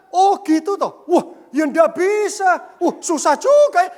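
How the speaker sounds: loud, high, hammed-up empathetic replies; husband acting out exaggerated sympathy for his wife; mock eager sympathy. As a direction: overplay the attentive husband. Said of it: "Saya responi, responi".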